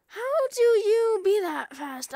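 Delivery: loud, slow mock outrage; mock shout. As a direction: stretch it out loud. Mock yelling at character.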